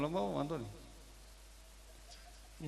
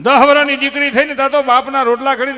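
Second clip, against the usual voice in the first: loud, high, swelling sermon cry; impassioned preaching; 9 semitones higher, 9 dB louder than usual. Acted. Preacher telling listeners why daughters' farewells bring tears, impassioned. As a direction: lift it like a preacher moving the crowd.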